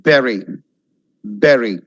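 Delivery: Bury as low, firm "BERRY"; correct pronunciation. Shown as a demonstration. Teacher repeating right Bury, correct pronunciation. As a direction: say Bury with a short E.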